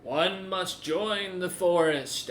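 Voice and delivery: slow, solemn ancient tree; lightly voiced solemn tree spirit; talking tree. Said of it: "tree voice continues".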